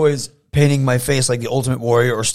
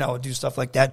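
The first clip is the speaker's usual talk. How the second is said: ordinary talk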